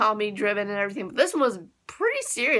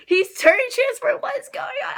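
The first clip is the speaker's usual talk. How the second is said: much higher, loud fan outburst; excited squeal; 13 semitones higher, 4 dB louder than usual. Real, not acted. Fan exclaiming at the transformation, high-pitched and excited. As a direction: yelp it in excited shock.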